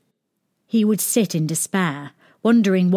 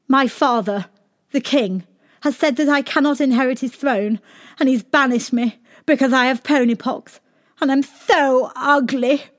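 higher, sorrowful pony prince; 7 semitones higher, 3 dB louder than usual. Acted. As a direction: lament like a moping storybook prince. Prince character voice.

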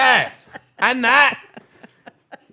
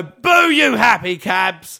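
loud, drawn-out boo; mock booing; 7 dB louder, much slower than usual. Real, not acted. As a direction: boo them with a slow, loud jeer. Booing the taxi firm.

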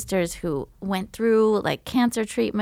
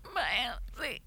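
scared whispered confession; whispering bad news to mother; 4 semitones higher, 6 dB quieter, slower than usual. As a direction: barely breathe it out to mom. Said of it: "I'm like".

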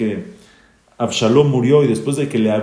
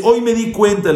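higher-pitched, heated scolding of the king; stern rebuke; 9 semitones higher, 3 dB louder than usual. Retold or imitated. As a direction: press the king hard with each accusation. Joab rebukes the grieving king. (reprimenda acalorada de Joab al rey)

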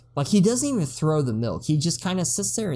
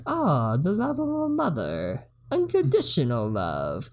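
put-on old lady wistful; 3 semitones higher than usual. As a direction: muse it like a wise old lady. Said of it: "she's like".

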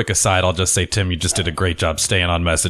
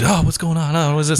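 high, bewildered clueless voice; clueless candidate; 8 semitones higher than usual. Retold or imitated. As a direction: play a lost, confused politician. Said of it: "not somebody who's".

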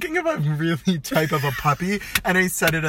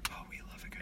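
whisper; 21 dB quieter, much faster than usual. Pretend. Drops to a whisper.

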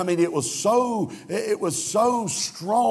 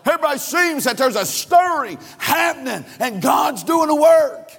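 higher and louder, shouting the build; fiery preaching; 7 semitones higher, 3 dB louder than usual. Acted. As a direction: preach it out over the congregation. Preaching at full force.

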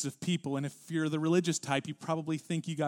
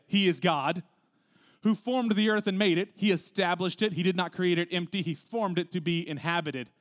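solemn, weighty read of God's own words; scripture reading; 3 semitones higher, 4 dB louder than usual. Acted. Pastor reading Isaiah aloud to the congregation, solemn and weighty.

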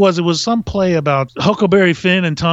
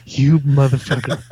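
a touch of a furious actor, giggling through it; voicing the glaring actor while laughing; laughing mock snarl; 4 semitones lower than usual. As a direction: mouth the curse while cracking up. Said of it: "he looked at me like".

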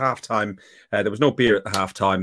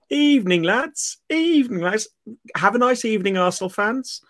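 much higher, sing-song mock greeting; mocking steward; 12 semitones higher than usual. Imitating the rival steward's smug stairwell greeting in a high sing-song voice. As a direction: imitate him, chirpy and smug.

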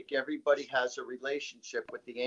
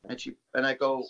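normal talking voice

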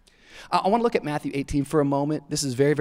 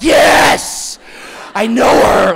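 put-on high, loud shouted toddler defiance; fierce little-kid yell; 7 semitones higher, 10 dB louder, much slower than usual. Three-year-old boy answering the park worker, fierce shout. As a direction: belt it like a fired-up small child.

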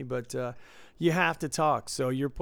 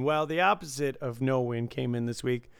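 normal talking voice

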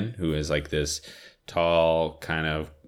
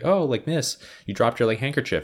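put-on polite young man; 8 semitones higher, 3 dB louder than usual. Ryan politely returns a handkerchief.

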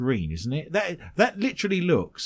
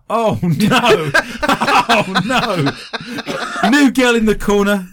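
loud laughing outburst; cracking up, can't stop laughing; 5 semitones higher, 5 dB louder, much slower than usual. Real, not acted. Co-host gets sick.

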